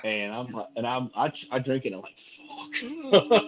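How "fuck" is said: blurted curse. Retold or imitated. Quoting own reaction to seltzer.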